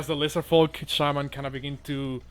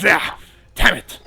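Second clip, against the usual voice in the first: loud, sharp curse of defeat; frustrated curse; 3 semitones higher, 8 dB louder than usual. Acted. Lizardfolk shaman losing his troops, loud frustrated outburst. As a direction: spit it out like a beaten shaman. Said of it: "shakes his fist in frustration and says".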